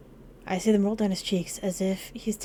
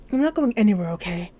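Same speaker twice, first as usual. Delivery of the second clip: louder, firm and insistent; raised voice, emphatic promise; insistent reassurance; 5 dB louder than usual. Acted. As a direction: insist forcefully that you are staying. Acted insistent reassurance to distraught Finn.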